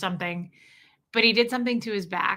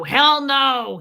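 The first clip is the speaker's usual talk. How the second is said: loud, higher mock-indignant refusal; imitated growl; 5 semitones higher, 8 dB louder than usual. Partner answering her teasing after a cough, mock growl. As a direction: imitate his growled comeback, punched loud. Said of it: "He'd growl at me".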